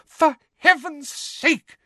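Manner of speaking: very high, drawn-out exasperated scream. A narrator's reading. Mother after the twins' flying stew crashes, screaming. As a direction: scream it slowly in outrage. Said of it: "screamed Missus Weasley".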